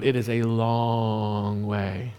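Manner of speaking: LONG stretched way out, low and soft; drawn-out emphasis. Real, not acted. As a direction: drag out LONG as the voice sinks. Emphasising how long the walk was.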